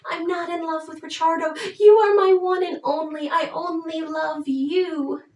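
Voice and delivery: high, cooing reassurance; comforting. Retold or imitated. Alcina comforts her jealous lover, high cooing reassurance. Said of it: "she actually tries to comfort him".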